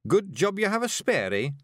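high Scots voice, chipper; Scottish Feegle, cheering her. A narrator's reading. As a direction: console brightly in a high Scottish voice.

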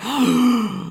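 loud high mock gasp; shocked gasp. Reacting to Juju's nudes comeback, loud mock gasp. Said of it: "And I was like".

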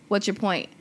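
ordinary talk

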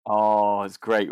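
ordinary talk

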